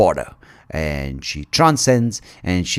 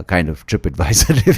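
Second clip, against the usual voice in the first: usual speaking voice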